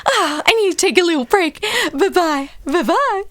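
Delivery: a hint of the high doll voice; chirpy doll goodbye, lightly done; lightly voiced Barbie. Barbie waving off guests, a hint of high chirp. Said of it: "I'm Duergar Barbie".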